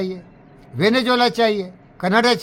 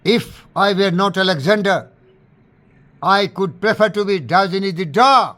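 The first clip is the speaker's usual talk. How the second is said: loud declaration; 4 dB louder, much slower than usual. Retold or imitated. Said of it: "और जोर से बोला".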